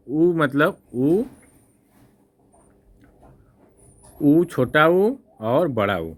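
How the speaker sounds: उ said slowly and clearly, short then long; teacher drawing out उ for students; slow vowel demo. Shown as a demonstration. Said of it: "teacher demos short and long u". (उ को धीरे-धीरे स्पष्ट)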